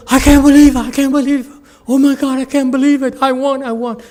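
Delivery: high, loud overjoyed winner; ecstatic disbelief. Retold or imitated. Retelling the Survivor winner, high overjoyed disbelief. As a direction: squeal it like you just won big.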